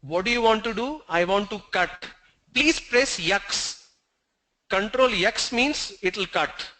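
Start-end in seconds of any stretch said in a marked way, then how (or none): 2.5-3.9 slow, higher "yaks" for X; "yaks" with a y glide in front; South Indian letter X. Shown as a demonstration. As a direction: say X as "yaks", drawn out. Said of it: "This is pronounced as".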